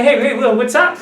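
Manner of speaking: high, lively hail to a friend; friendly greeting. Shown as a demonstration. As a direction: greet a buddy with a rising "what's up". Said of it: "come to me as a friend".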